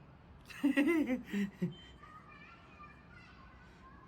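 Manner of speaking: a high, airy burst of laughter; high breathy laugh. Real, not acted. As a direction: laugh at your own joke, high and breathy. Laughs at own boast.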